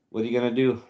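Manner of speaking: ordinary delivery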